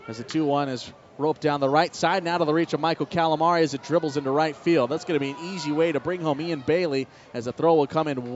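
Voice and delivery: play-by-play. Acted. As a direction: sell the RBI single like a broadcaster. Live baseball play-by-play call.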